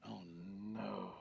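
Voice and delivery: long, drawn-out mock dismay; dismayed groan. Listener seeing the punchline coming, groaning dismay. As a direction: groan at a terrible pun. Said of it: "She went".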